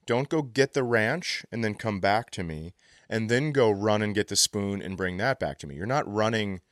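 ordinary delivery

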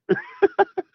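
high-pitched laugh bursting out; sudden high laugh; burst of laughter. Real, not acted. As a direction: crack up at your own story. Laughs at own story.

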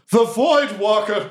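high, slow awestruck cry; awed goblins. Acted. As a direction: marvel like a crowd seeing a legend. Goblins react in awe to the player.

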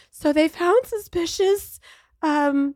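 young doctor nervously delivering bad news; nervous resident. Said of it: "and she's like".

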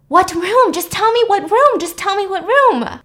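high, loud, desperate demand; panicked yelling. Retold or imitated. The dean screaming at the avenger for her daughter's room, panicked. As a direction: scream it as a frantic mother. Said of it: "the dean starts freaking out".

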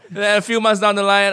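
ordinary talk, nothing marked

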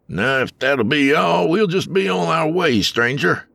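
high, wary frontier driver; gruff wagon driver. A narrator's reading. Wagon driver turns away a stranger, wary and high. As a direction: brush off the stranger.

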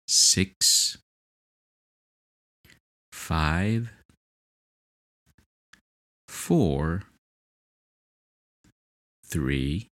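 soft, slow hypnotic countdown; slow soothing countdown. Acted. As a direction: count down gently with long pauses.

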